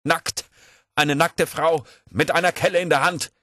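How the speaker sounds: high, loud outburst; acted shouting. A narrator's reading. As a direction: cry it out defiantly through the beating. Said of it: "schrie Puskow". (hoch und laut geschrien)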